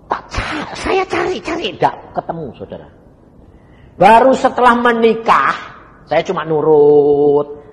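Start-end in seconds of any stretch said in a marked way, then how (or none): 4.0-7.6 preaching shout. Real, not acted. Preaching peak, loud.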